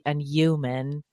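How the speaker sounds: put-on New York accent, human as yuman; and human with the H dropped, yuman. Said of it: "I love it when you say".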